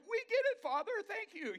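high-pitched, loud, overjoyed outburst; giddy cry of sudden understanding; elated gratitude. Acted. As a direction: burst out with delighted thanks. Heaven's hosts rejoice to the Father.

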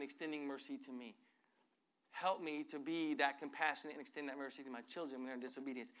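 soft, hushed retold prayer; a lightly voiced humble prayer. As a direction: quietly pray it back to God.

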